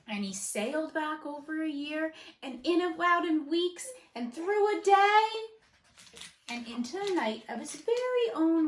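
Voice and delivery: lively read-aloud storytelling for first graders; reading to children. Acted. Teacher reading to her first graders, animated storytelling. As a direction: read it out like story time in class.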